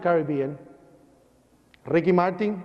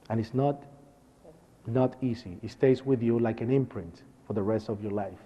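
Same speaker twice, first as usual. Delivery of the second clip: low, subdued and halting; heavy-hearted, sinking softer with long pauses; real grief held quietly; sad; 6 semitones lower, 5 dB quieter than usual. Real, not acted. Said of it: "And you know, it's sad".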